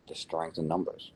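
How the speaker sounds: ordinary talk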